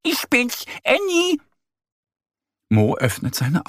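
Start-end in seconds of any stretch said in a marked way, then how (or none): 0.0-1.4 much higher little duck calling. Acted. Annie calling to sleepy Mo at his door, high and bright.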